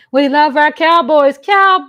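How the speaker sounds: loud high team cheer. Real, not acted. Cheering for the Cowboys in a loud chant. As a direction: belt it like a fan chant.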